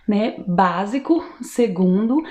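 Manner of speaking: ordinary delivery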